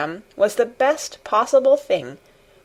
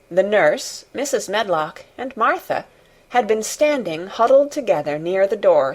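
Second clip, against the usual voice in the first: usual speaking voice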